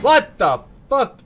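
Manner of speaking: loud, high shocked outburst; a real jolt of shock, shouted; shocked yell. Real, not acted. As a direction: blurt it out in disbelief. Sudden shock in game.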